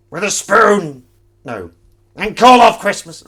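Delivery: very high, loud shriek; loud squeal. Pretend. Reading chat's quips aloud, shrieking high. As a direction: screech it out.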